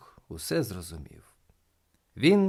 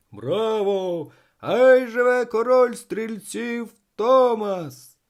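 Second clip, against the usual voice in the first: loud, high, drawn-out acclaim; cheering crowd; 11 semitones higher, 8 dB louder, much slower than usual. A narrator's reading. Crowd greeting the king of shooters, loud cheering. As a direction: hail the champion at full voice.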